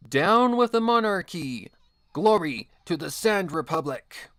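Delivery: high, loud rallying yell. A narrator's reading. Narrator voices a rebel kicking and shouting against the monarchy, yelling. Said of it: "kicking while yelling".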